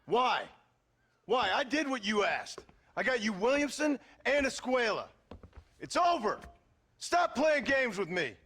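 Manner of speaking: rising, high-pitched anger; furious outburst. Acted. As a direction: shout that the job is done, voice climbing.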